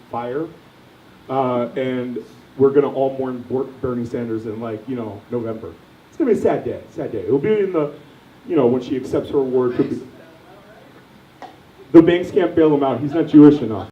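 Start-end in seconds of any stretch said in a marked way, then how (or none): none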